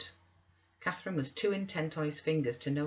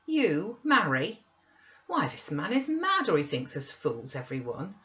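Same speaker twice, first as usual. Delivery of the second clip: incredulous exclamation; 6 semitones higher, 3 dB louder than usual. A narrator's reading. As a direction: scoff at the very idea. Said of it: "I exclaimed".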